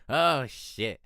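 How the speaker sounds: ordinary delivery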